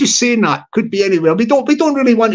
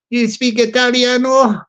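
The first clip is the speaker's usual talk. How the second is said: ordinary delivery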